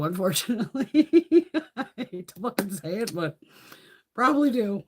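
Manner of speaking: giggling through the words; sheepish laughter while confessing to a viewer; laughing. Real, not acted. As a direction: laugh at your own confession. Admitting she owns every palette.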